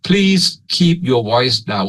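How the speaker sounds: slow, clearly separated words in Thai-accented English. Shown as a demonstration. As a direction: pronounce each word distinctly, Thai-style English. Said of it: "สำเนียง Eng- English Thailand เลยนะครับ". (พูดอังกฤษสำเนียงไทย ชัดๆ ทีละคำ)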